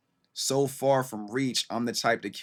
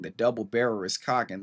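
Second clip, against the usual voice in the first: ordinary talk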